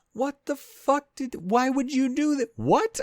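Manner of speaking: high, loud, drawn-out disbelief; loud squawk of bafflement; incredulous outburst. Retold or imitated. Acted outraged reaction to spoiler message.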